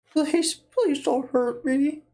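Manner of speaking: put-on high, slow, trembling old man plea. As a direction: beg like a frail old man. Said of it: "this is my default old man voice".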